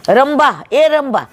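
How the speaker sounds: high, ringing call to a cow. Acted. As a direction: shout reassurance across the field. Herdsman calling his cow.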